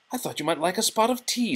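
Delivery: high, quick, motherly; kindly teapot voice. Acted. Mrs Potts offering Belle tea in kind teapot voice. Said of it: "said Missus Potts".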